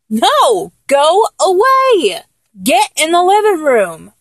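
acted exasperation, stretching the words out; annoyed shooing. Telling a clingy lover to go away, annoyed.

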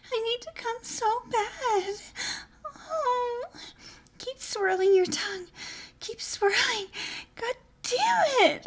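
climax building. Acted. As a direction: build toward the peak.

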